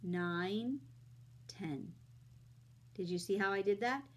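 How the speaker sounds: put-on friendly teacher talking to kids; kids show host. Teaching kids addition on camera.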